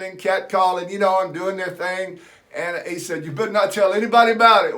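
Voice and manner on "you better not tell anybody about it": quick, loud mock-stern warning; coach warning. Coach warns the boy to keep the secret, loud and fast. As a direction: rattle it off like a gruff coach.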